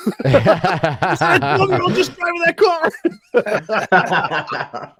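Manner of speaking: high, loud, laughing disbelief; shocked bystander. Retold or imitated. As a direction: gasp it out through laughter. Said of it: "people were like".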